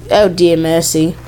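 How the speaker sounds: ordinary talk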